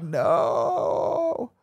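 high-pitched, long "no" without shouting; retold wail of despair, held long and high; drawn-out mock cry. Leia's cry as planet is doomed.

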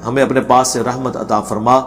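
ordinary talk, nothing marked

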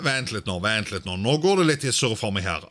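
ordinary delivery

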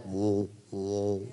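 low, soft "whoa" as UFO rumble; imitated vibrating hum. Preacher imitating movie UFOs over a city, low vibrating sound. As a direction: imitate a craft hovering overhead, low and soft. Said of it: "that kind of vibrating feel".

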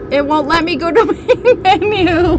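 high-pitched, breaking into laughter; helpless laughing. Real, not acted. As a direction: squeal it through desperate laughter. Laughing helplessly at being stuck.

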